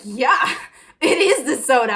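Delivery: relieved laughing shout of "it is the soda"; laughing agreement. Real, not acted.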